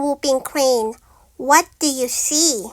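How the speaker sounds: usual speaking voice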